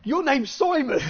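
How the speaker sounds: lightly acted firm correction; retold insistence. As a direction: act out an officer contradicting the prisoner. Acting police insisting on the name.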